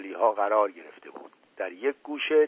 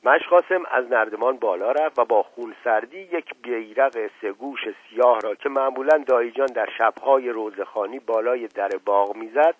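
ordinary delivery, nothing marked

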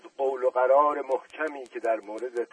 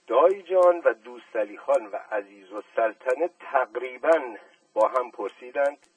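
usual speaking voice

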